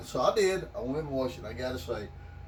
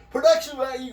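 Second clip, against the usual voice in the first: high, loud, showy "Production value"; mock director voice; 10 semitones higher, 8 dB louder than usual. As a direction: quote the kid director grandly. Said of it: "He's like".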